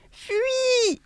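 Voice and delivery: high, urgent cry to flee; shouted warning. A narrator's reading. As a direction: yell it up high to wake the sleeper. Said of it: "cria de nouveau le lièvre".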